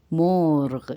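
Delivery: مورغ as one long syllable, "moorgh", no final ee; Persian pronunciation. Shown as a demonstration. Teacher contrasts it with Urdu murghi, Persian pronunciation. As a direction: stretch مورغ and stop on the gh. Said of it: "مرغی کو فارسی میں کہتے ہیں".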